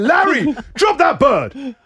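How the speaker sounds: high, slow, firm plea; mock reasoning with the cat. Owner pleading with his cat to drop the bird, high and slow. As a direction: coax the cat to let go, slowly. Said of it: "I had to try to reason with him".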